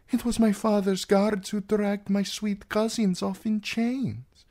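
high, youthful princess voice; aggrieved princess. Acted. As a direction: accuse the guards in a lifted voice. Princess voices her fear and grievance.

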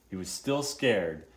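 ordinary delivery, nothing marked

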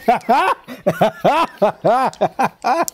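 long, high, cackling laughing fit; uproarious laugh. Real, not acted. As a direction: crack up helplessly at length. Cracking up at the scene.

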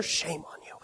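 whispered teasing aside; mock-scolding whisper. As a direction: whisper a playful reproach. Teasing the audience for knowing.

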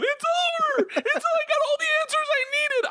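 high wailing cry, half-joking; hammed-up sobbing squeal; mock bawling. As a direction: wail it out like you're weeping at a finale. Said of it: "I was bawling, crying, going".

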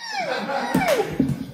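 very high falsetto squeal through a laugh; mock muffled squeal. Comedian imitating the woman under the pillow, falsetto squeal. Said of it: "she's like".

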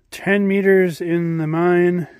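ordinary talk

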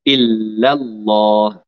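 slow إلا الله with strong doubled lam; teacher shows it slowly; model recitation. Shown as a demonstration. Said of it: "teacher models recitation".

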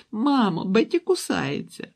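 put-on high, loud little-girl complaint; tattling child. A narrator's reading. Girl tells mother her sister bites, high-pitched tattling. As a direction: call out to Mom to tell on a sister.

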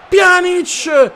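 high, loud shocked cry, slow on Dio santo mio; stunned gamer shouting at the screen; shocked outcry. Real, not acted. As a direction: call out in disbelief. Sudden event in match.

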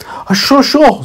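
high, hesitant squeaky carrot. Acted. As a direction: mumble agreement nervously, high. Carrot agrees nervously.

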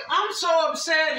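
mock upset. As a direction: ham up his distress. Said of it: "he's like".

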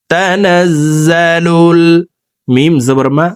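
ordinary talk, nothing marked

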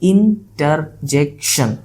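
ইন্টারজেকশন slowly, in four separate beats; syllable-by-syllable demonstration. Shown as a demonstration. Teacher giving another four-syllable example, ইন্টারজেকশন broken by syllable. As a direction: sound out ইন-টার-জেক-শন piece by piece. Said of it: "আবার দেখো".

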